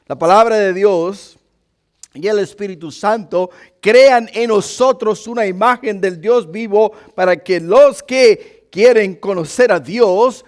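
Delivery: loud, high fiery preaching. Acted. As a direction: preach with full force. Preaching builds to full force.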